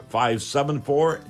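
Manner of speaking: normal talking voice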